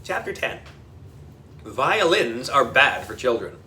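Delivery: ordinary delivery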